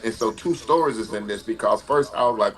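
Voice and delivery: ordinary talk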